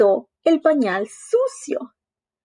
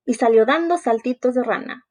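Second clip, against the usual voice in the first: ordinary delivery, nothing marked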